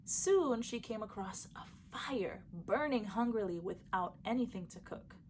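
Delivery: ordinary delivery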